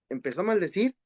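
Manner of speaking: ordinary delivery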